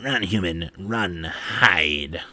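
hushed, throaty jackal taunt; crackly jackal voice. Acted. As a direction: attempt a crackly alien yell from afar. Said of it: "I can't do their crackly voice".

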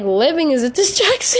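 high, loud and fast, laughing through it; laughing quote. Retold or imitated. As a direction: blurt it out, cracking up. Exasperated quote about distraction.

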